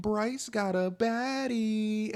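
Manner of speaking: high, slow, drawn-out cheer; locker room hype. Retold or imitated. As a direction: stretch it out like teammates cheering. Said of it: "and they were like".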